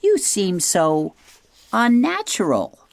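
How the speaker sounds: rising high screech of distaste. A narrator's reading. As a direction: screech it with disgust. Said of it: "screeched the owl".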